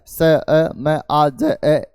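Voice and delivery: स, अ, आ, ज, ए said as separate sounds with pauses; sounds split apart. Shown as a demonstration. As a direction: spell out समाज sound by sound. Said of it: "इन ध्वनियों से मिलके बना है समाज". (समाज को ध्वनि ध्वनि करके तोड़ना)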